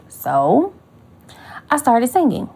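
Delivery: ordinary talk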